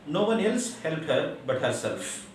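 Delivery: ordinary talk, nothing marked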